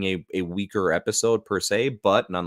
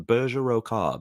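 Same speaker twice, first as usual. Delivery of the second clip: British accent; 4 semitones higher, slower than usual. Shown as a demonstration. Streamer trying out a new name, British accent. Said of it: "when you say that in a British accent".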